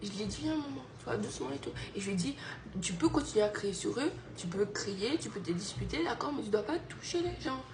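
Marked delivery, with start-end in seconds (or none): 2.8-7.6 put-on soft, calm explaining to a child; softly and gently, to her son; gentle explaining. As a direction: reassure him gently. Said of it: "J'ai expliqué ça gentiment".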